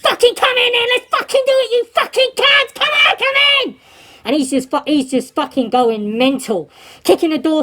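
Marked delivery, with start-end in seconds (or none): 0.0-3.8 high, strained yell of challenge; retold screaming. Naked prisoner provokes the screws, retold screaming. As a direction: scream it high, goading them to fight. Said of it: "He's screaming at 'em".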